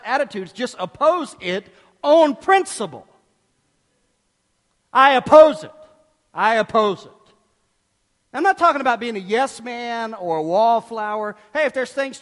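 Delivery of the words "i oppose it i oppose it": loud, emphatic refusal, easing on the repeat; mock-defiant outburst, punched hard then firm; stubborn objection. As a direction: dig in and declare it forcefully. Acting out a stubborn opposer.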